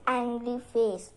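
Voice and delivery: usual speaking voice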